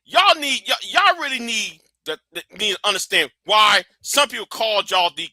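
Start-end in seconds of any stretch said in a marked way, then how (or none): none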